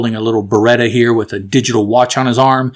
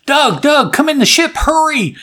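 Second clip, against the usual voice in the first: much higher, urgent call; retold yell; 13 semitones higher than usual. Kelly calling Doug into the departing ship, urgent high call. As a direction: call out to hurry him aboard. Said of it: "Kelly yells out".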